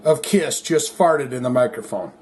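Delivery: ordinary delivery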